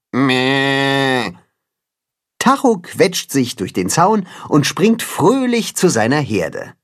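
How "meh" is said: long, level "meh" bleat; goat bleat. A narrator's reading. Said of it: "Die Ziegen rufen alle gleichzeitig durcheinander".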